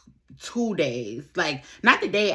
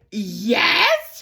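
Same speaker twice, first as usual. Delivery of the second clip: mock shrieked yes, half laugh half cry; 5 semitones higher, 5 dB louder than usual. Friend confirming she knows Good Time, laughing shriek. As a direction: blurt it out laughing in shock.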